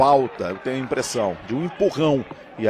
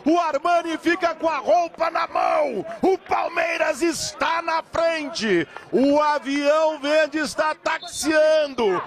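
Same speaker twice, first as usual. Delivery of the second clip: goal celebration shout; 11 semitones higher, much slower than usual. Real, not acted.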